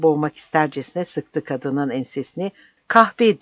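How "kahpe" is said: "Kahpe!" shouted high and loud; angry shout. A narrator's reading. Captain choking the woman, shouting "Kahpe!" in rage. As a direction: spit the insult out in rage. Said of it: "diye haykırdı".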